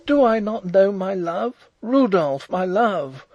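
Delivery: high, loud, indignant outburst; superb indignation. A narrator's reading. Princess defending her love to the marshal, indignant. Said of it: "she asked in superb indignation".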